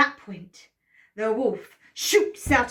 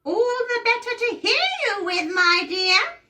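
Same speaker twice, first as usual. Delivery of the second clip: put-on much higher, sweet old granny voice; wolf as grandma; 12 semitones higher, 5 dB louder than usual. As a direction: coo it like a false sweet granny. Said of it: "And Grandma says".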